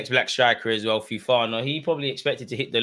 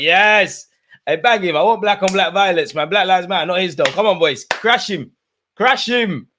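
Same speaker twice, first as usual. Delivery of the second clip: loud, high yelling for a fight; egging on; 7 semitones higher, 8 dB louder than usual. Real, not acted. Fan yelling at the players to crash him, loud. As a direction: roar them on to crash him.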